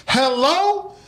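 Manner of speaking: put-on high, strained hello from a confused old lady; aunt who can't hear the phone calls out; old aunt's hello. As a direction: squeak it like a deaf old auntie. Hard-of-hearing aunt on phone.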